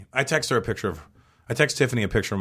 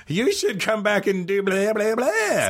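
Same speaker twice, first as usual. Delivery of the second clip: idiot fanboy; 11 semitones higher, slower than usual. Pretend. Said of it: "what I would have done".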